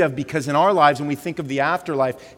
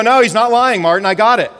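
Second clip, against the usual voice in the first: mock listeners; 6 semitones higher, 11 dB louder than usual. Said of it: "all of you are gonna go".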